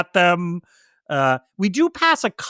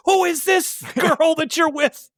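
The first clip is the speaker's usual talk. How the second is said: high, shouted accusation; jealous wife shouting; 8 semitones higher than usual. Retold or imitated. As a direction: yell it like a suspicious wife.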